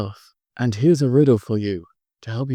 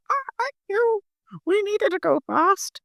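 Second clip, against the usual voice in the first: very high squeaky elves, grateful; little elves cheering, much higher. Acted. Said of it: "all the elves go".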